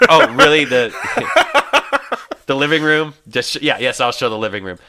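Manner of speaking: high, loud laughing mock agreement; sarcastic laughing. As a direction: laugh at the obvious request. Sarcastic reply to realtor.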